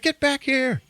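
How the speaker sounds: high-pitched imitated call; calling after them. Knife trainer to fleeing recruits, mock high-pitched call. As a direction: voice leaps way up to call people back.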